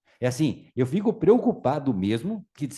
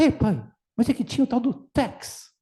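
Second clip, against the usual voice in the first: put-on high little kid voice; a boy explaining to his dad; child explaining; 8 semitones higher, 3 dB louder than usual. Boy explains the tax to his father, high childlike.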